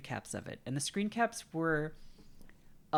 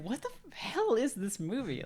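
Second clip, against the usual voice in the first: incredulous; 8 semitones higher than usual. Retold or imitated. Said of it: "I was like".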